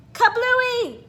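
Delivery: loud, high burst word; playful explosion; kablooey. A narrator's reading. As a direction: make it pop. Balloon bursts.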